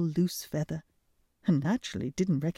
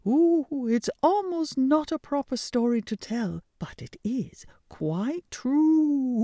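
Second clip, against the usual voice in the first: high scandalised owl voice; gossiping owl; 8 semitones higher than usual. Acted. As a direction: tell it as juicy scandal. Owl telling the pigeons.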